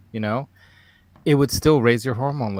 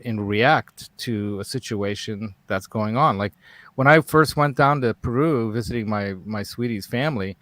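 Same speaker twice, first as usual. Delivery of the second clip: usual speaking voice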